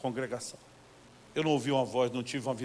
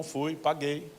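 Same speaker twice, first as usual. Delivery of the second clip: ordinary talk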